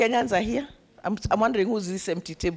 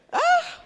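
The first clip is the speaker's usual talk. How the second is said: surprised shout; 7 dB louder than usual. Real, not acted. Surprised cry.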